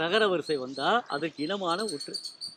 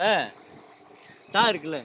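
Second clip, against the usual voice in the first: ordinary talk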